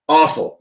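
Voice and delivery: Awful thrown out firmly, falling; emphatic repeat. Real, not acted. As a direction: lean hard into Awful.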